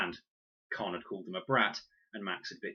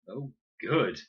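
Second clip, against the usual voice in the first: fake enthusiasm; 4 semitones higher, 8 dB louder than usual. A narrator's reading. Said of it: "lied Fred".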